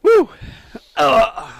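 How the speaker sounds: choking on water; sputtering after water went down wrong; coughing up water. Real, not acted. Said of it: "that water went down the wrong pipe".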